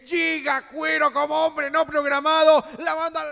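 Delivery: loud, high goal call; goal commentary. Real, not acted. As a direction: keep the goal roar going.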